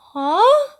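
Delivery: sharp, high, loud huh; startled yelp of a question; surprised. Retold or imitated. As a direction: caught completely off guard. Told to leave with Mister Thomas.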